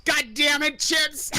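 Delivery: high-pitched scolding outburst; hammed-up angry shout; mock exasperated shout. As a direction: yell at him in playful exasperation. Mock scolding of friend on stream.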